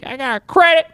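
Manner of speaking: high, put-on old man; grandpa voice. Grandpa voice calling a restaurant for credit. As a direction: play a frail grandpa on the phone. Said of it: "talk, like, in a grandpa voice".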